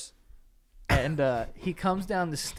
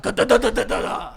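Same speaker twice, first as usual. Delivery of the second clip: louder mock thump-thump of a body hitting stairs; thudding noise imitated; 5 semitones higher, 6 dB louder than usual. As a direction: bang out each da like a fall. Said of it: "it was like".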